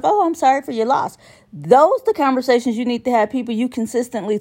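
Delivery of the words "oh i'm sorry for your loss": high, fast polite condolence; mock sympathetic voice. Mocking a sympathizer, high quick "sorry for your loss". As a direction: rattle off fake sympathy up high. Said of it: "be like".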